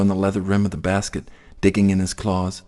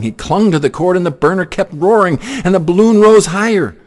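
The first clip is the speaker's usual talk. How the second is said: rising higher and louder toward the end; mounting alarm; 9 semitones higher, 7 dB louder than usual. A narrator's reading. Narrator tells the danger as the balloon climbs, building, louder and higher.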